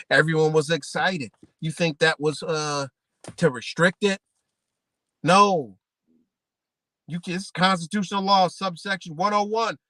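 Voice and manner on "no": a loud, firm no; emphatic no. Real, not acted. Answering his own question, a loud firm no. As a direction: shut the question down.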